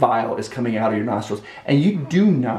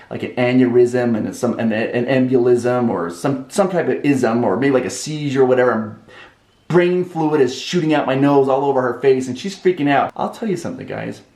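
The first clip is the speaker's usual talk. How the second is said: usual speaking voice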